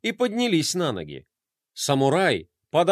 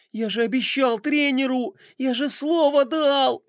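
put-on distressed boy; 9 semitones higher than usual. Roma frets about his promise to the coach, anguished. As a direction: despair over a broken promise.

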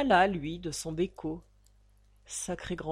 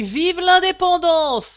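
loud, high, drawn-out cry of the slogan; shouted slogan; 11 semitones higher, 14 dB louder, slower than usual. A narrator's reading. Narrator shouting a Belleville street cheer, loud and high.

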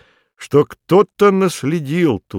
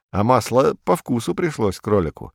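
usual speaking voice

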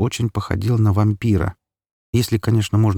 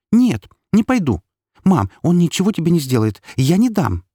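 put-on high, louder child's voice; stubborn little girl; 10 semitones higher, 4 dB louder than usual. A narrator's reading. Girl refusing to leave her mother, stubborn high voice. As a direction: dig in as a defiant small girl.

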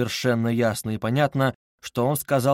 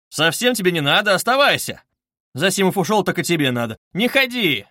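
loud, high insistent orders; emphatic raised-voice protest; 7 semitones higher, 5 dB louder than usual. A narrator's reading. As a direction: press him firmly to stay. Friend stops another from leaving.

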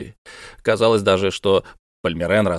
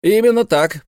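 firm, emphatic agreement, higher and louder; deep conviction; 11 semitones higher, 4 dB louder than usual. A narrator's reading. Professor confirms to the captain, emphatic and certain. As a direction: stamp the answer with total certainty. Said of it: "подтвердил профессор с глубоким убеждением".

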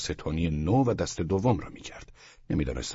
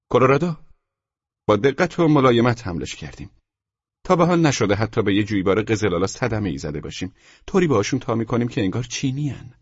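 higher, louder shop seller; emphatic, pitched-up salesman; salesman character; 5 semitones higher, 6 dB louder than usual. Acted. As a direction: rattle off the sales terms in a raised voice. Seller boasts of careful hauling.

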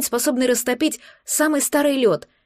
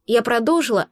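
plain reading voice

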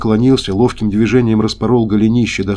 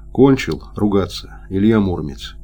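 ordinary delivery, nothing marked

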